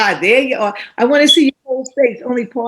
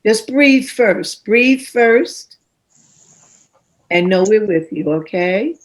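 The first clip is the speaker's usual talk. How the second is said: calm reassurance. Real, not acted. Host calming a nervous young poet before she starts, gently reassuring. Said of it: "host calming nervous poet".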